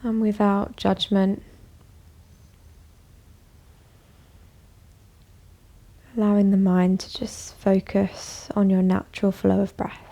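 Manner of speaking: calm, even voice with long pauses; guided meditation. Acted. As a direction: guide them gently inward, leaving space after each phrase. Leads a bedtime meditation.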